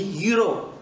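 Scottish YOU KNOW, like yu-ro; Scottish falling you know; Scottish accent. Shown as a demonstration. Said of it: "En écossais, ça se dit".

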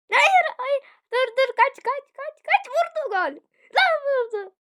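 high, very loud panicked yelling; frantic screaming. Real, not acted. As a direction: scream at them to run for their lives. Under attack in game.

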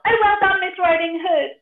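high, loud cheering pig; cheering piggy. Acted. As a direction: yell it out delighted. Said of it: "stood and yelled".

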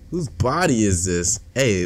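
normal talking voice

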